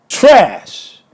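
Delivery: one angry, emphatic word with a sharp fall; real disgusted outburst; contemptuous verdict. Real, not acted. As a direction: throw it down as a final insult. Spitting out a final insult.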